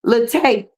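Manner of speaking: Latte said luh-TAY, weak first vowel; mispronounced Latte. Shown as a demonstration. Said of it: "That's how they say Latte".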